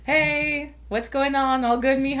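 high, sing-out casual greeting; imitating friend's text. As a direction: read the check-in up high, as someone else. Voices his out-of-the-blue text.

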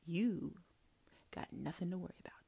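fast breathy whisper, low and intimate; whispered catchphrase. Acted. Prophetess whispering the catchphrase to her class. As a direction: whisper the lesson like a secret.

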